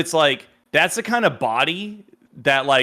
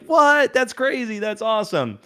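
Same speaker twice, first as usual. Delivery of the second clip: high imitated astonishment, easing down; amazed reaction; 8 semitones higher than usual. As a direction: act out friends thrilled by big news. Imitates friends' amazed reaction to news.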